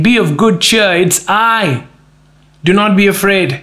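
high-pitched upbeat call; acted breezy greeting; cheerful reassurance. Acts out Jesus' cheerful greeting.

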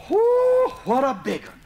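high, loud whoo of surprise; excited whoop. Retold or imitated. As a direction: whoop at the size of it. John finds the coon up top.

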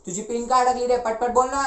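loud, irritated demand; impatient scolding. Acted. Elder snaps at the stuck reader.

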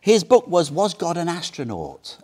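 ordinary delivery

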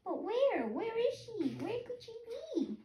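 high piping voice rising in worry; a lost chick fretting aloud; baby bird voice, worried. Acted. Hatchling wondering where mother is, high and worried. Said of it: "my baby bird voice".

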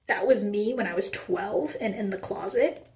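ordinary talk, nothing marked

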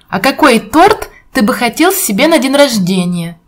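normal talking voice

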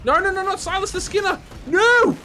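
high panicked no no no; panicked shout. Real, not acted. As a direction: yelp it out in alarm. Boss fight, panicked cry.

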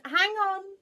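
high, loud, drawn-out call; commanding shout; abrupt halt. Acted. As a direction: break in on the speller. Noah halts the rhinoceros.

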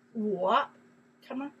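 ordinary talk

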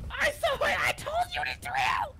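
high, loud, terrified yell; screaming that the ghost is real; frightened shout. Real, not acted. Player sees the ghost, shouts.

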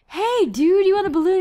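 high, eager offer; happy townsperson. Acted. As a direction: chirp it like a friendly local. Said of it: "and they're like".

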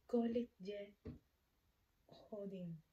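Kolik ye hodin word by word, long gap before hodin; broken-up teaching pace. Shown as a demonstration. Teacher showing learners how to ask the time, word-by-word delivery.

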